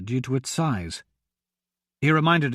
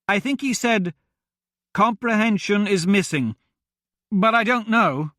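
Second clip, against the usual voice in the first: Arista shouting; 6 semitones higher than usual. A narrator's reading. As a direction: call across the dark room. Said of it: "she shouted".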